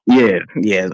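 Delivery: ordinary talk, nothing marked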